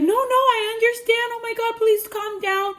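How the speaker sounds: high, loud imitated squeal; frantic pleading. Friend begs upset caller to calm down, frantic pleading. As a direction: mimic someone begging for calm.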